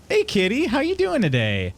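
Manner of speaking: sing-song high hello; bright put-on welcome; high greeting voice.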